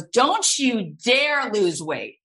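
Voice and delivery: high, forceful mock orders; hammed-up bossy director laying down the law; insistent commands, pitch climbing high as they build; Steven Spielberg. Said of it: "And he goes".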